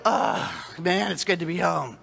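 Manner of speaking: relieved sigh. Retold or imitated. Walking in your own front door after vacation, a long relieved sigh. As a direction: let the whole body settle as you say it.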